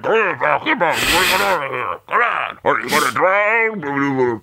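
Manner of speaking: gargled, garbled shouts as if submerged; underwater voice. Acted. Coach yelling at a beaten boxer underwater, gurgling. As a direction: bubble the words out as though underwater. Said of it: "beaten into a figurative underwater state".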